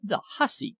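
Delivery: a theatrical father pretending to be scandalised; mock outraged cry. A narrator's reading. Father mock-scolds his daughter Sally, playful theatrical outrage. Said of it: "cried Athelny".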